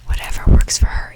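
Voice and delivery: ordinary talk, nothing marked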